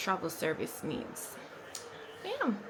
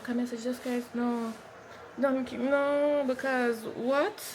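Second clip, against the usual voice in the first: calling out; 5 semitones higher than usual. Real, not acted. Shooing someone off camera.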